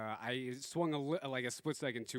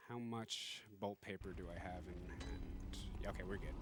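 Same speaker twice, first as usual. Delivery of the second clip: muttering; 6 semitones lower, 10 dB quieter than usual. Real, not acted. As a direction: mumble it low. Muttering to self.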